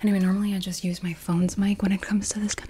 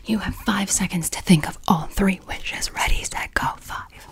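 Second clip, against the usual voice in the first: ASMR whisper. Real, not acted. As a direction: whisper close to the mic.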